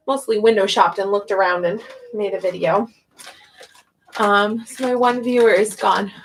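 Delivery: usual speaking voice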